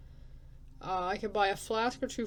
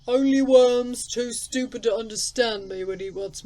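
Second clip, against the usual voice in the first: reprimanding elder; 2 semitones higher, 10 dB louder than usual. Acted. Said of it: "reprimands you with a thick accent".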